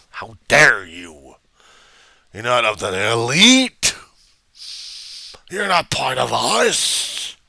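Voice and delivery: slow, loud preacher rant; slow, loud mock preacher tirade; practicing a speech. Shown as a demonstration. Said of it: "Notice the fist at this point".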